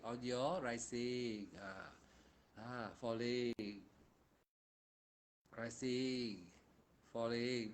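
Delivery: soft, slow guiding of rising and falling; guided meditation. Acted. Monk guiding students through meditation, soft and slow. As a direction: ease them into the breath quietly.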